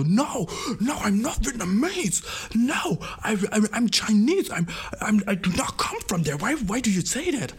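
guy denies looking Vietnamese in a high flustered voice; imitating the Chinese guy. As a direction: squeak out a flustered denial. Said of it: "Er so".

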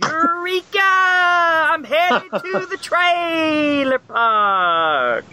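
high, drawn-out mock holler. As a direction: holler it like a trailer-park hooligan, stretching the vowels.

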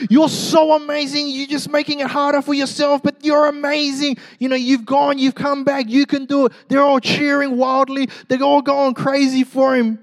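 very high, loud frenzied crowd; wild cheering crowd. Retold or imitated. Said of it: "And the crowd goes".

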